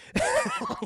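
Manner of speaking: high cackling laugh; burst of laughter. Real, not acted. Streamer tickled by the drunk friend, high laugh. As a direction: crack up mid-story.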